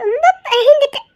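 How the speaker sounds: sobbing with relieved laughter to her husband; tearful laugh. Acted. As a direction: relief spilling out in tears.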